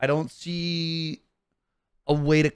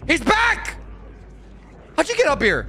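startled; 14 semitones higher than usual. Real, not acted. Creature returns, startled.

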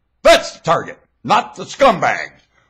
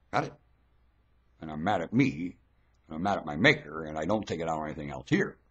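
ordinary delivery

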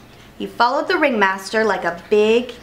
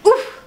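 high, short oof on landing; storybook grunt of impact; sudden squeaked oof, louder; 5 dB louder than usual. A narrator's reading. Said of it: "said Pinocchio".

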